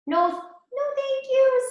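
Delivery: high, polite pig voice; polite little pig. A narrator's reading. Pig politely refusing the wolf, high. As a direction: turn the wolf down sweetly as the pig. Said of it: "said the pig".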